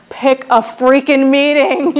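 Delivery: loud, slow, drawn-out exasperation; exasperated dad. Retold or imitated. Dad fed up with her excuses, exasperated imitation.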